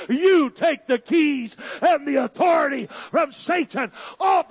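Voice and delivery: loud, slow climbing preacher's shout; full-throated pulpit shout; preaching at full force. Acted. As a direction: drive it home to the congregation.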